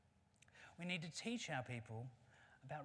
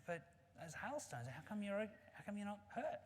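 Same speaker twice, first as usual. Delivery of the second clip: lightly voiced monkey, higher pitch; puzzled monkey; 7 semitones higher than usual. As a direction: ask as a baffled little monkey, pitched up. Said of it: "Monkey says".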